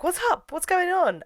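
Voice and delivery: high-pitched, lightly acted concern; concerned questioning. Marion asks upset Claude what is wrong, mock concern. Retold friend asking distressed Claude.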